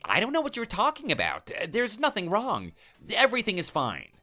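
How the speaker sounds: high, hurried cover-up; nervous denial. Acted. The neighbour denies anything is wrong to the suspicious husband, nervous. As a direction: act innocent while hiding something.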